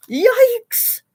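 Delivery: high, loud startled yikes; high alarmed yelp. Acted. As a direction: jump at the sight. Big dinosaur approaching in story.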